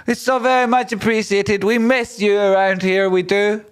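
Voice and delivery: very high falsetto gratitude; over-the-top squeaky thank-you; mock gushing thanks. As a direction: thank them in a silly, sustained high voice.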